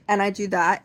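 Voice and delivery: ordinary delivery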